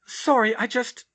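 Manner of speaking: high flustered apology; stumbling embarrassed girl. Acted. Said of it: "she started".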